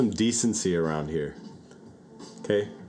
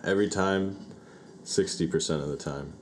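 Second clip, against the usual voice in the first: ordinary talk, nothing marked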